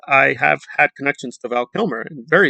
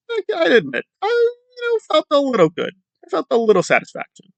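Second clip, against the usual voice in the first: much higher, strained voice admitting pride; smug killer confession; 13 semitones higher than usual. Retold or imitated. The serial killer admitting he felt good, high-pitched and strained. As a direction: squeeze it out high like a gloating killer. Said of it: "He says".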